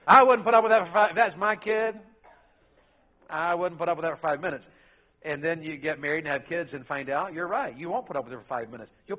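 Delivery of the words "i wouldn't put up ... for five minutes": put-on know-it-all childless boast; mock smug parent boast. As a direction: act sure of yourself before kids. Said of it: "We say dumb things like that".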